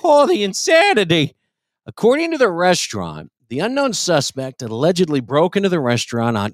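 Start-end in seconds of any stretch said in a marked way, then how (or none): 0.0-1.3 high theatrical wail of despair; mock dramatic lament. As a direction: ham up the tragedy like a soap opera.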